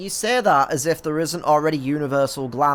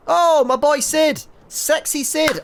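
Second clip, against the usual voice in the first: high, drawn-out fan squeal; over-the-top delighted cheer; hammed-up excited greeting; 14 semitones higher, 6 dB louder, slower than usual. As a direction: gush loudly over a favourite, pitched way up. Hammed-up greeting of favourite character.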